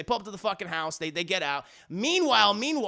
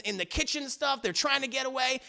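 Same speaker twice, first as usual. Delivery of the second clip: ordinary delivery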